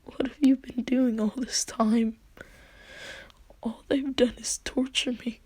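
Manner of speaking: soft, breathy retold lament; mock tearful. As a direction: lightly act a hurt, tearful complaint. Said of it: "like, crying".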